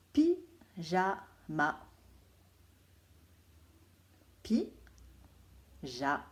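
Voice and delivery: Pyjama drawn out syllable by syllable; teacher dictating a word slowly; slow dictation. Shown as a demonstration. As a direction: pronounce Pyjama very slowly so every syllable is heard. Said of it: "il faudra bien les écouter".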